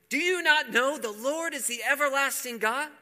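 high, loud, fervent proclamation; preaching at full force. Acted. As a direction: drive the verse home to the crowd. Preacher repeats scripture, shouting.

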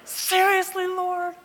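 high, loud, hammed-up wail; exasperated. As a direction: protest to heaven in a drawn-out, high-pitched cry. Acted exasperated complaint to the Lord.

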